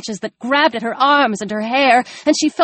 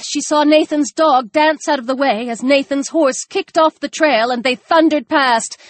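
ordinary delivery, nothing marked

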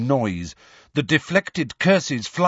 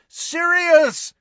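very high, long bellowed call; 3 dB louder than usual. A narrator's reading. Harry calling into the archway for Sirius, bellowing. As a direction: bellow the name to summon him back. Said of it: "he bellowed".

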